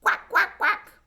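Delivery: eager duck greeting; duck quacking. A narrator's reading. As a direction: quack like a cheerful little duck. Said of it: "The pigs arrived next".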